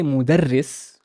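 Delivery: "mudarris" with a doubled, held r; three syllables, mu-dar-ris, long r in "mudarris"; shadda pronunciation. Shown as a demonstration. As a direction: hold the r in "mudarris". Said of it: "how a shadda can actually change the whole meaning".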